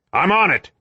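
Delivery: high shouted reply; yelled. A narrator's reading. Perry answering Eugen across the battle, yelled. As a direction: yell back the answer over the noise. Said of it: "Perry yelled".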